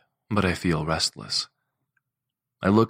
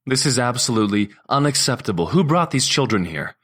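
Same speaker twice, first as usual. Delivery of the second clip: higher, louder stern rebuke; stern courtroom voice; 6 semitones higher, 5 dB louder than usual. A narrator's reading. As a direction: scold them from the bench. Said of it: "I've put on my courtroom voice".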